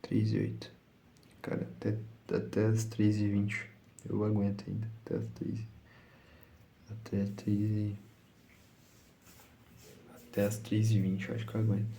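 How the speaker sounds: drowsy, half-asleep murmur; barely awake, fading into whispers; nodding off. Real, not acted. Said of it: "eu tô morrendo de sono".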